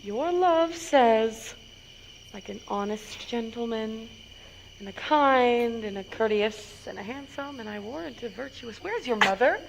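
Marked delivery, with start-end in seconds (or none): 0.0-7.0 teasing hush. Acted. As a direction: tease by holding it back quietly. Nurse teases, drawing it out.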